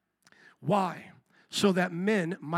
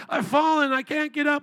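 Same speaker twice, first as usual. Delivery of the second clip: higher, louder mock helpless old woman; old lady from commercial; 7 semitones higher, 4 dB louder than usual. Preacher mimicking the old TV commercial, higher helpless plea. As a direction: ham up the fallen old lady's plea. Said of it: "anybody remember that commercial".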